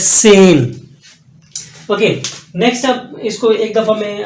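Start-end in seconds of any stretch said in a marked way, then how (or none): 0.0-0.8 सेन said loud with an s start; German zehn. Shown as a demonstration. Teacher drills German ten, loud and firm. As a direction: hit सेन hard with s. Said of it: "एस की आवाज़ दे रहा है".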